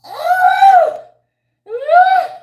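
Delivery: high, loud gushing squeals; thrilled. Real, not acted. Telling viewers they make her feel good, thrilled. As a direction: squeal out your delight.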